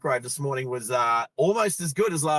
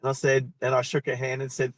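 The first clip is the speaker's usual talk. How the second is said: ordinary delivery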